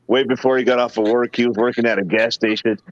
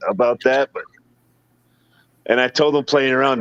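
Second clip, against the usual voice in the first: normal talking voice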